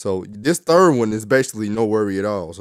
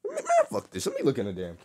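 frustrated outburst; 3 semitones higher, 6 dB quieter, faster than usual. Real, not acted. Frustrated while tying do-rag.

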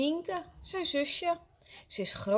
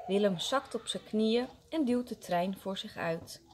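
ordinary delivery

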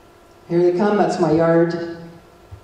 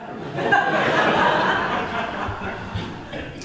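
quiet breathy laugh; soft laugh; 5 dB quieter than usual. Real, not acted. Laughing at the undercover claim, a quiet breathy laugh. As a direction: let a soft laugh slip out.

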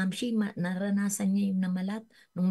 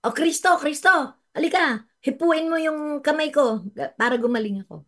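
a much higher, louder pushy call; demanding shout; 9 semitones higher, 6 dB louder than usual. Retold or imitated. Preacher mocking a pushy demand to Christ, loud high shout. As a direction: order Christ over as if he owes you. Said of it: "Hindi sila nagsabing".

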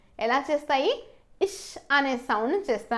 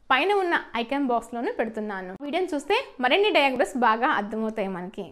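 ordinary talk

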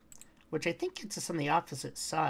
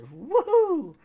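high, loud whoop of joy; excited woohoo; 12 semitones higher, 10 dB louder than usual. Real, not acted. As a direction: let out a gleeful whoop. Cheering after a kill.

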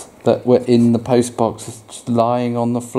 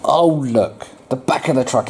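ordinary delivery

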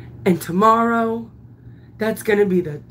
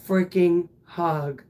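ordinary delivery

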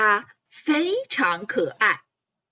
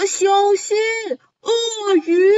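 higher shouted warning; yelled warning; 6 semitones higher, 4 dB louder than usual. A narrator's reading. 动物们一起大声警告. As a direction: shout it in alarm. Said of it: "大声喊道".

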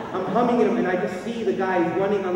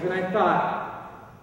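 ordinary delivery

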